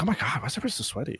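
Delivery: quick, soft mock grumble; mildly annoyed. Player grumbling to self about sweaty matches, mildly annoyed. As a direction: rattle it off in mild exasperation. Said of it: "be a little annoyed".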